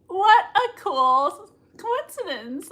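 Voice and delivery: high, slow, put-on cheerful; mock fake-sweet. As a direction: fake sweetness hiding fear. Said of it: "She's like".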